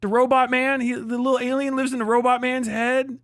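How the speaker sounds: ordinary talk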